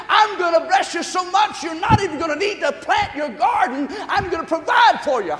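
fervent preacher in full flow; full-force preaching. Real, not acted. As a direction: proclaim God's promise in a high, loud voice.

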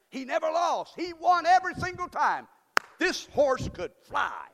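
loud, high hype about the winning horse; preacher whooping up a joke; hyped-up shout. Acted. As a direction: belt out how the horse always won.

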